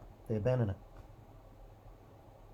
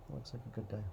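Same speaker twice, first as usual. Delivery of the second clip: the same line repeated much softer, half to himself; quiet echo; 10 dB quieter, faster than usual. Pretend. Planner repeating his verdict to himself, much softer. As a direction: murmur it back to yourself, satisfied.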